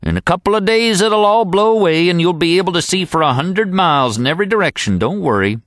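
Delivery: calm the worried newcomer; old guide reassuring. A narrator's reading. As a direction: guide promising the fog will lift.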